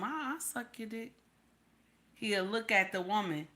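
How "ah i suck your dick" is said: quiet, coaxing offer; soft seductive. Retold or imitated. Another woman tempting the husband, quiet. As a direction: purr it softly. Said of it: "a woman tell him".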